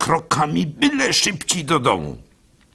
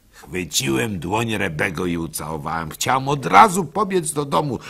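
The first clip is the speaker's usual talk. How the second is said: ordinary delivery, nothing marked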